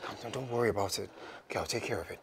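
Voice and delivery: very soft, gentle reassurance; softly murmured comfort; hushed soothing. Acted. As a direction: settle a frightened person quietly. Husband soothing his frightened wife.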